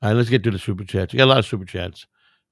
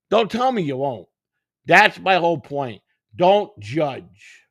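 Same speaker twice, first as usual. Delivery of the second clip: angry rant; 7 semitones higher, 7 dB louder, slower than usual. Real, not acted. Angry rant, telling viewers off.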